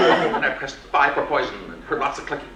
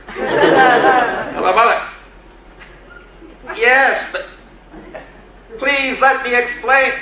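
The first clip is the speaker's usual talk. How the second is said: high, loud eager greeting; bright rush to placate his mother; eager hello; 12 semitones higher, 7 dB louder, slower than usual. Retold or imitated. Son calling his mother back, high and eager.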